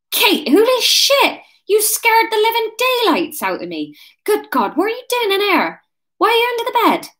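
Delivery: very high, startled West Country voice; West Country Wurzel. Acted. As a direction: squawk it in broad West Country. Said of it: "Ryan's gonna be a Wurzel".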